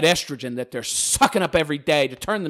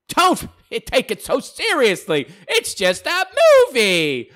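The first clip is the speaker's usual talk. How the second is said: sarcastic mock quote; 9 semitones higher, 5 dB louder, slower than usual. Sarcastically voicing opponents.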